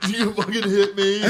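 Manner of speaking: high, loud wounded whine; mock tearful outburst. Drunk son accusing dad who hit him, mock tearful outburst. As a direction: bawl the accusation, half laughing. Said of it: "I was sobbing".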